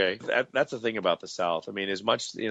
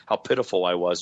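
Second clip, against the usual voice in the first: ordinary talk, nothing marked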